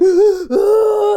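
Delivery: high, loud gasping sob; sobbing gasp. Retold or imitated. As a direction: gasp for air mid-cry. Crying as mum arrives.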